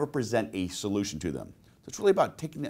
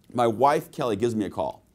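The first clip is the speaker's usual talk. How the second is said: usual speaking voice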